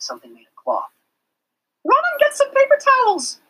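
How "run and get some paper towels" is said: put-on high, loud call to the children. A narrator's reading. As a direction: call across the room as the teacher. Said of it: "she called to the other children".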